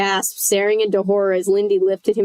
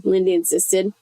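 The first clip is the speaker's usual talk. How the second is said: ordinary delivery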